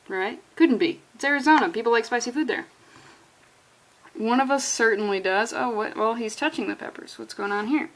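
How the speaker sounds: ordinary talk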